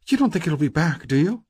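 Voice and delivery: high, worried female character voice; anxious woman. A narrator's reading. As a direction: ask for reassurance, pitched high. Stacy asks, eyes wide.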